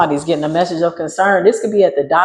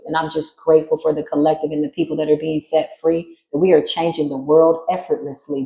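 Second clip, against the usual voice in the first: ordinary talk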